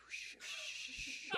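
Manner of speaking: ordinary talk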